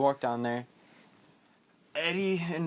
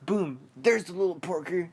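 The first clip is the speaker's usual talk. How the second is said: loud, higher-pitched mock bully taunt; 5 semitones higher, 4 dB louder than usual. Bullies spotting Ben on his walk home, loud mock taunt. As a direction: call out the victim like a bully. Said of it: "It's the, it's the bullies".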